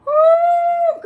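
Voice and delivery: real high-pitched squealing laugh into a whoop. Real, not acted. Auntie cracking up at a commenter, shrieking laugh.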